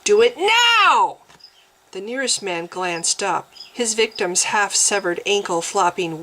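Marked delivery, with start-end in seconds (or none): 0.0-1.2 loud, slow, high order; loud command. A narrator's reading. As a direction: demand it now. Commanding the attendants.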